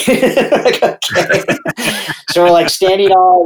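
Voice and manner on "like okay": laughing. Real, not acted. Laughing at trooper's absurd order.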